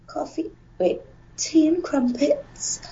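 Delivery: soft, tentative British accent; hushed practice run of British vowels; British accent warm-up. Shown as a demonstration. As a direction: feel your way quietly into a British accent. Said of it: "You need a gateway word, guys, for accents".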